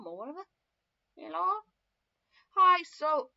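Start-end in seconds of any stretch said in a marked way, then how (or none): 1.2-1.6 raised phone greeting, moderately exaggerated; loud, lifted hello; second-try hello. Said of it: "He didn't say it like that". 2.6-3.3 very loud, high hi; mock phone greeting. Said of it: "this isn't what he says either".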